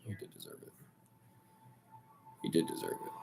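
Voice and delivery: voiceless whisper, almost to himself; grudging whisper. Real, not acted. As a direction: breathe it out like you hate admitting it. Grudging admission repeated under breath.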